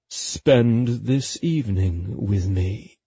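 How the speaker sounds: low, slow, seductive menace; silky, dangerous invitation; delicious menace. A narrator's reading. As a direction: ask it slowly, dropping the pitch. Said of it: "he said slowly and softly, and full of delicious menace".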